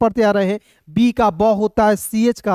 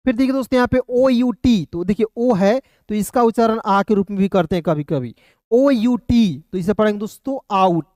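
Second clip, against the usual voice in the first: ordinary delivery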